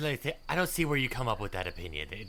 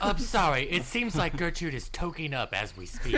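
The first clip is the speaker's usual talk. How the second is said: laughing; 6 semitones higher, 5 dB louder than usual. Real, not acted. Laughing joke.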